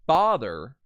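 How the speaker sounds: father with a loud, strong FA; stress on first syllable. Shown as a demonstration. Teacher models two-syllable word, stress on FA in father. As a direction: hit the FA in father. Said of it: "put the emphasis on the fa".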